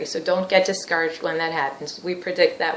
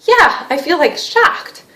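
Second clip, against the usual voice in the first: high, loud, taken aback; acted shock; 9 semitones higher, 8 dB louder, slower than usual. Wife confirms her feeling to the therapist, shocked. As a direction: burst out in startled agreement. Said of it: "Is that kind of like a shock?".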